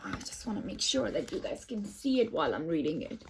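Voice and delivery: plain reading voice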